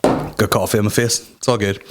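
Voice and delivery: usual speaking voice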